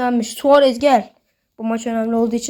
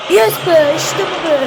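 loud, high cheer of joy; real goal cheer; celebrating; 5 semitones higher, 5 dB louder, slower than usual. Real, not acted. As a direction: yell it out after the goal. Celebrating a scored penalty.

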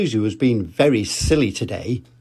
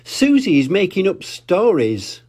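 put-on higher tattling child voice; a hint of complaining little pig; Peppa telling tales; 7 semitones higher than usual. Peppa telling on Susie to mummy, lightly higher. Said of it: "cried Peppa".

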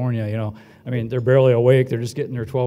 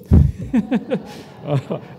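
breathy silent laugh at his own pun; a quick airless laugh; unvoiced chuckle; 7 semitones higher than usual. Real, not acted. Host laughs at his own Dublin joke, unvoiced chuckle.

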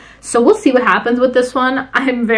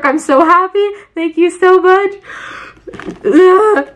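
high, loud gushing thanks; 8 semitones higher, 3 dB louder than usual. Real, not acted.

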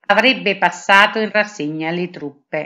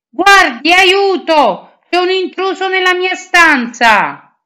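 much higher, louder lady's cry; screaming for help; 11 semitones higher, 6 dB louder than usual. Acted. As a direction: call the guards in alarm. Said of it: "e gridò". (grido acuto e fortissimo d'allarme)

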